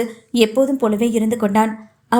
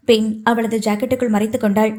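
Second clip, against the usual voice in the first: plain reading voice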